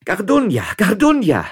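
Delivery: high, loud hailing call; calling out repeatedly. A narrator's reading. Woman calls for the bailiff by the river, loud call. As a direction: holler the name into the distance. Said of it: "rief die Navareserin wiederholt".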